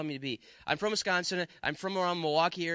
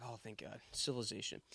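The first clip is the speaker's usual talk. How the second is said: much softer, lower, breathing out relief; soft relieved sigh; 7 semitones lower, 14 dB quieter than usual. Retold or imitated. Preacher retelling the McDonald's sighting, soft and lower with relief. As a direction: let the words sink out in relief.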